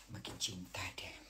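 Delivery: low, breath-only whisper; very soft, hushed swoon; lovestruck whisper. Real, not acted. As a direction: whisper it to yourself, smitten. Admiring herself, speaking of love.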